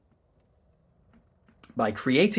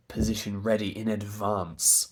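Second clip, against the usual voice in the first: ordinary delivery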